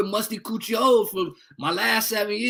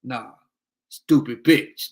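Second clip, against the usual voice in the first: slow, louder, contemptuous put-down; dismissive insult; 7 semitones lower, 4 dB louder, slower than usual. Pretend. Telling the judge what she'll call the ex, slow and scornful. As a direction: drawl it out with scorn. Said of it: "I'ma just say".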